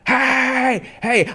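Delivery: high, loud wordless-style shouting; high loud hey chant; yelling hey. Retold or imitated. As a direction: bark hey like a wild man. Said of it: "I'm just like".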